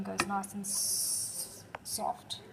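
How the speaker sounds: hissing the s in "s-" long and slow, like a snake; drawn-out s like a snake. Shown as a demonstration. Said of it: "Sound like a snake there".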